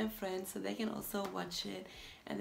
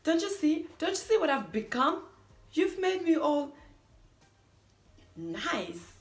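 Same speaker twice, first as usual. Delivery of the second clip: high, loud dramatic outcry; distressed accusation; 6 semitones higher, 8 dB louder than usual. Acted. Accusing a partner of changing you, distressed outcry. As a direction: plead with them to see the damage.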